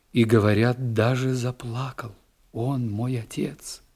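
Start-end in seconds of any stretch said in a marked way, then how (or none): none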